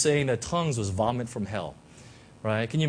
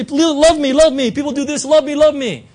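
pleading for love; 11 semitones higher, 12 dB louder than usual. Retold or imitated. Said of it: "people put this stuff and say".

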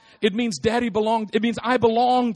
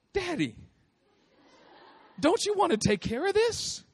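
put-on high, pleading little girl with a laugh; little daughter's voice; 10 semitones higher, 5 dB quieter than usual. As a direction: coax daddy like a small child. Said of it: "And she goes".

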